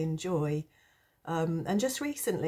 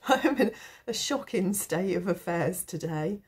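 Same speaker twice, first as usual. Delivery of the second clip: talking through leftover giggles; words shaken by real laughter; laughing at oneself; 3 semitones higher than usual. Real, not acted. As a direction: laugh ruefully at your own sorry state. Laughing at her own poorly state.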